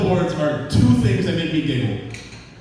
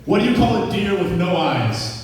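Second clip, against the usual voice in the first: normal talking voice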